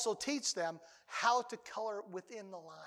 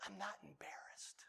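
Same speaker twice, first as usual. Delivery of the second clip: whisper; 2 semitones lower, 18 dB quieter than usual. Pretend. Pastor's ironic aside to congregation.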